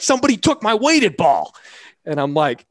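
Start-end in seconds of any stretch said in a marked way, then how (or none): 0.0-1.5 much higher and louder, an outraged complaint; hammed-up outrage; mock panic. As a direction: play the pitcher wailing that his ball was stolen. Panicked player retelling lost ball.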